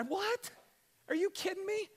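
high, startled what, are you kidding; incredulous disbelief. Retold or imitated. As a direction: play the stunned listener hearing the answer. Imagined listeners' disbelief.

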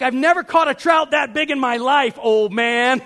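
loud, high indignant yell; mock angry outburst. Fisherman protesting to old man about trout, mock angry yell. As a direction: yell in outraged disbelief. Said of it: "I'm mad".

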